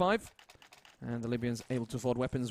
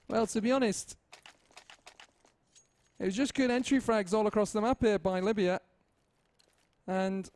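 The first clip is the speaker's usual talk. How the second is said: higher-pitched put-on second caster with an odd accent; Bob's voice; 9 semitones higher than usual. Caster invents co-caster Bob and answers himself in a higher put-on voice. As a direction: pose as a separate co-caster answering the question. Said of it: "what do you think about that, Bob?".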